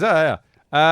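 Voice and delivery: lightly hammed-up mock indifference; mock shrug. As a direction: toss it off like nobody cares. Mocking the other clubs' shrug.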